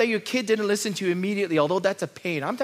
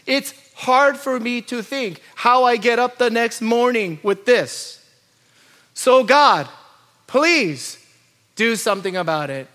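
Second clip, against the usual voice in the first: louder, urgent begging; desperate plea to God; 5 semitones higher, 5 dB louder than usual. Acted. Psalmist begging God for relief, louder and urgent. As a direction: cry out to God that you've had enough. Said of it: "the psalmist is commanding God".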